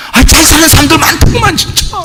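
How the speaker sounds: high-pitched, hammed-up grumbling; acted resentful complaint. Preacher acting out his old resentment of the well-off, high complaint. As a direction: imitate a wailing gripe at life's unfairness.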